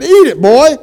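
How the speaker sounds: high, loud scolding father; retold berating. Father scolding his son at the restaurant. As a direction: snap at the boy, exasperated. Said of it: "I berated him".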